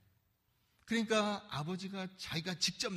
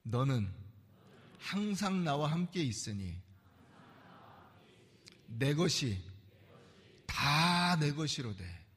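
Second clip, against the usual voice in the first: slow recitation model; 4 semitones lower, slower than usual. Shown as a demonstration. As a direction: read it slowly for the congregation to repeat. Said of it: "한번 따라해보세요".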